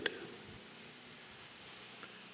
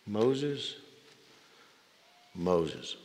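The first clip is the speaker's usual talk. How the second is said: hushed, loving repetition of the name; tender call; 2 semitones lower, 10 dB quieter than usual. Pretend. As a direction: say the name like a caress. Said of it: "a repetition of endearment".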